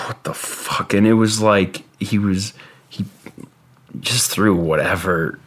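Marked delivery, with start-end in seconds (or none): 0.1-0.9 a breathless whispered what the fuck; whispered disbelief. Retold or imitated. Stunned by the brother's vision, whispered disbelief. Bewildered inner thought.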